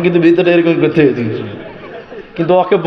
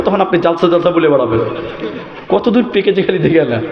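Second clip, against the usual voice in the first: ordinary talk, nothing marked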